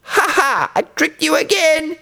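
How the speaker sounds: put-on high, teasing little-boy voice; playful high-pitched trickster boy; gleeful boy. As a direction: gloat in a high boy's voice with an acted ha-ha. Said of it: "laughed at the villagers".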